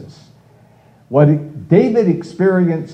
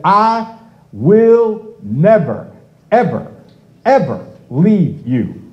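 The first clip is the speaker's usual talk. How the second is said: 'never', 'ever', 'ever' each stretched slow, first raised high and loud; emphatic never ever; 5 semitones higher, 3 dB louder, slower than usual. Retold or imitated. Preacher quoting God's promise to the congregation, emphatic stress on 'never, ever, ever'. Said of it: "Very emphatic".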